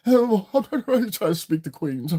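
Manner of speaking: high, drawn-out mock-refined voice; slow, exaggerated airs, mocking pretentious talk; phony decadent. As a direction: put on a phony, affected high voice. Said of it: "This is when you be trying to come decadent".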